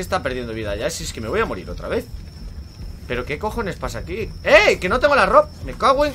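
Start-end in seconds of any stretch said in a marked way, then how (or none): 4.4-6.1 high, loud indignant yell; alarmed outburst. Real, not acted. Gamer discovering clothes are gone, indignant outburst.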